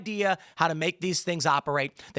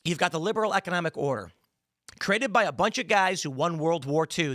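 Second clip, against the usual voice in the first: ordinary talk, nothing marked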